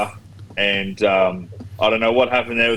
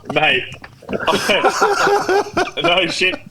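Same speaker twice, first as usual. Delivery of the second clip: laughing; 12 semitones higher, slower than usual. Real, not acted. Laughing through the story.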